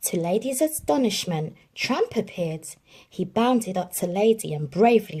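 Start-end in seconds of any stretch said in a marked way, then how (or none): none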